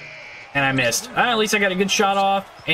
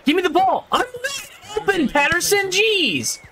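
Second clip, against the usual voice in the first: high, urgent shout for a pass, much higher; calling for the ball; 12 semitones higher than usual. Real, not acted. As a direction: demand the ball from a teammate.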